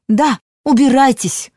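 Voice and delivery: loud, high, defiant yelling; shouting. A narrator's reading. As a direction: shout them out the door. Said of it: "кричала она".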